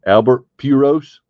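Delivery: loud "Pujols" said as PYOO-rohs; deliberate mispronunciation. Real, not acted. Card opener announcing a pull for fans, "Pujols" mispronounced PYOO-rohs. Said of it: "everybody loves me to say Albert Pujols".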